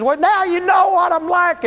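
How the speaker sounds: high, loud, defiant wife; wife daring husband to leave; defensive wife. Retold or imitated. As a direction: fling it out defensively.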